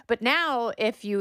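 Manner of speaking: ordinary talk, nothing marked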